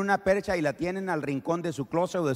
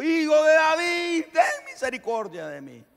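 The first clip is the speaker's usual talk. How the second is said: insistent cry; 13 semitones higher, 5 dB louder than usual. Acted. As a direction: refuse to be silenced. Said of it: "le importó poco".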